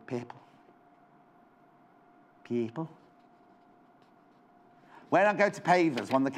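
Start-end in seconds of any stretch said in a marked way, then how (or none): 0.1-0.4 soft mock Scouse; Liverpool accent. Shown as a demonstration. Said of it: "as they say in Liverpool". 2.4-2.9 soft, lower mock Scouse; Liverpool accent. Shown as a demonstration. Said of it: "as they say in Liverpool".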